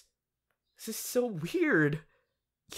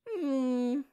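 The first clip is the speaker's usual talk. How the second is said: ordinary talk, nothing marked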